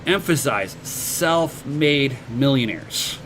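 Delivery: slow, deliberate "emphasize self-made millionaires" with weight on "self-made"; slow emphatic "self-made millionaires"; stress on self-made millionaires. Shown as a demonstration. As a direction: lean hard on "self-made", drawing the words out slowly. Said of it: "and I, I do".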